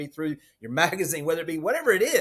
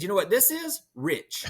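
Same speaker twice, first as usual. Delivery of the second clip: higher retold punchline; 7 semitones higher than usual. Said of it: "I'm not gonna use his Louisiana accent".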